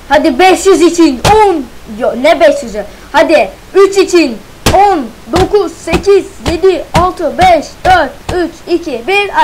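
loud, high hyped countdown; excited countdown. Real, not acted. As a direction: count down like a launch, pumped up. Excited countdown while crafting.

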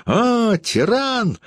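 very high-pitched squeal; acted outraged shriek, little added volume. A narrator's reading. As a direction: squeal the accusation at top pitch. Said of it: "взвизгнула Юлия".

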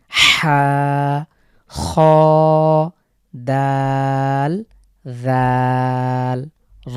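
হা, খ, দাল, জাল, র each drawn out slowly; Arabic letters recited. Shown as a demonstration. Teacher reciting letters for viewers to repeat, slow. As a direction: recite the letters one by one, stretched. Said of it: "আমাদের সাথে একটু try করুন".

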